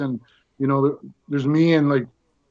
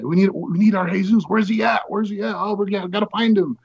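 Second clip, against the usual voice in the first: higher-pitched mock fretting; urgent searching; 5 semitones higher than usual. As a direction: act out a crew hunting for someone. Crew anxiously searching, acted out.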